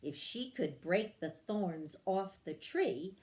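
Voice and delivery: plain reading voice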